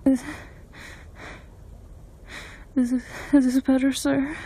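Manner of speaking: breathless and stammering; out of breath. Acted. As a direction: gasp between stuttered words. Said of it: "I was breathing so hard and stuttering".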